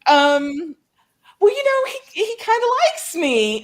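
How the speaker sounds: high, tentative reply to an angry parent; mock timid. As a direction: imitate a nervous daughter faltering on the phone. Daughter answering her angry father.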